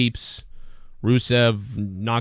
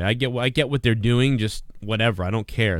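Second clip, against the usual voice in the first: ordinary talk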